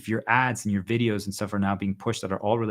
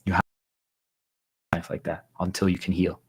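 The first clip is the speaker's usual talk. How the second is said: whisper; 4 semitones lower than usual. Real, not acted. To stream viewers, urging them to heal, whispered. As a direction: let it fall to a whisper.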